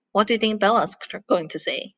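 plain reading voice

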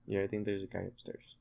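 ordinary talk

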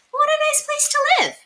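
high, delighted lady's voice; a pleased lady at the window. Acted. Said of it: "looked out the window ... and said".

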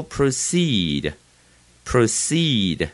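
proceed slowly, long strong CEED; end stress. Shown as a demonstration. Teacher drilling new words, proceed stressed at the end. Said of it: "重音在后面呢".

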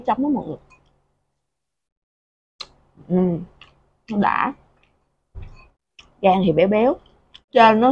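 chewing while talking; muffled with food in mouth; mouth full. Real, not acted. Seen 1: Food taken in at -3; cheeks full, chewing through labelled words.

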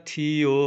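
"ਥੀਓ" with long held ee and o; drawn-out vowel. Shown as a demonstration. Teacher modelling it like the earlier word, "ਥੀਓ" drawn out. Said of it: "ਇਹ ਵੀ ਲੰਮਾ ਕਰਕੇ ਬੋਲਣਾ".

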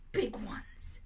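soft, breathy aside; whisper. Real, not acted. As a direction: whisper it to yourself. Aside after "we have issues.

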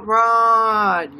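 ordinary talk

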